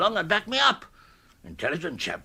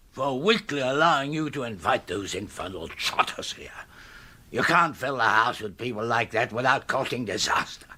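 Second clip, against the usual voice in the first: ordinary talk, nothing marked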